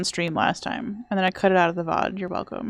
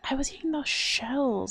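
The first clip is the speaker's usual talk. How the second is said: higher, softer whispered admission; whispered confession; 5 semitones higher, 6 dB quieter than usual. Real, not acted.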